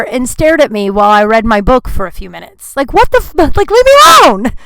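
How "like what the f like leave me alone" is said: much higher and louder, fed up; exasperated outburst. Real, not acted. About the man staring while she reads, exasperated outburst. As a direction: cry out to be left alone.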